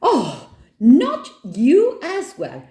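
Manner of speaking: high, loud, drawn-out protest; high-pitched outburst of annoyance; exasperated shout. A narrator's reading. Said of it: "shouts Nora".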